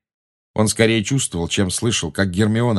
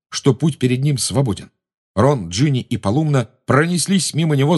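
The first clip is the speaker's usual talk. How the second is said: ordinary delivery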